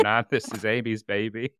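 normal talking voice

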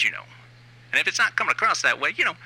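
usual speaking voice

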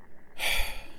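soft, drawn-out exhale; weary sigh. Acted. Boss brooding on losing ground, weary sigh. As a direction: let out a quiet heavy breath. Boss worried about being exposed.

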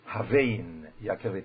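"Havein Yakirli" slow, with a v in Havein, as a question; correct reading. Shown as a demonstration. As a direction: stress "Havein" with a v, asking. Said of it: "You have to see".

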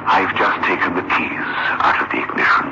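low, heavy storytelling tone; story narration. A narrator's reading. Narrator describes scene.

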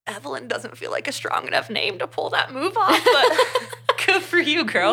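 high, giggly, laughing through the words; amused, breaking into high laughter; real laughter. Real, not acted. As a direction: crack up while talking. Joking about the name, laughing.

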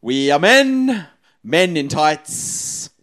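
loud, slow theatrical declaration; Men in Tights line. Shown as a demonstration. Host showing contestant an easier buzzer line, theatrical declaration. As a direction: stretch it out with hammy musical-comedy pomp. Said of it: "You are to say".

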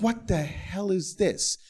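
high, drawn-out disbelief; shocked reaction. Retold or imitated. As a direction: stretch it out in pained alarm. Acted shocked reaction to pain.

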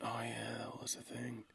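hushed whispered aside; whispering. Real, not acted. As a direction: whisper it under your breath. Aside remembering the website.

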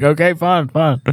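high-pitched, chuckling retold protest; mock exasperated surrender. Husband giving in to his wife, mock exasperated. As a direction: give in under protest, played for laughs. Gives in to wife's ultimatum.

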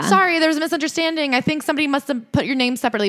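high, quick sugary apology; overly polite. Retold or imitated. Scientologists to [name] about seating, high, hurried and ingratiating. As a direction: imitate gushing, eager-to-please backpedalling. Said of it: "they're never rude to J Lo".

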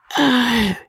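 high cackling laugh; old man's laugh. Acted. Old baronet cackling at his guests. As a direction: cackle like a sly old squire. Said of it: "He laughed".

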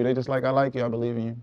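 ordinary talk

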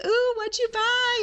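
very high, slow excited coo; delighted hostess. Retold or imitated. Hostess greeting guest with shopping bags, delighted squeal. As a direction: squeal with eager curiosity. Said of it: "She's like".

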